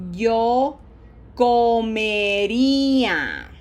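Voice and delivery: drawn-out Spanish drill, loud and clear; very slow modelling. Shown as a demonstration. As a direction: project it and hold each vowel long. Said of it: "that's why I go so slowly".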